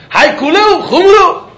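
high, slow, disbelieving; incredulous quote. Acted. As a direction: ask it like a puzzled challenge. Gemara's question mocked as weak.

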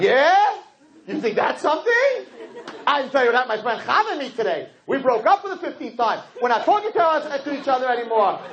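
wife outdoing her husband's bad-day story; mocking wife. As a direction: top his story with a grin. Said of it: "She's like".

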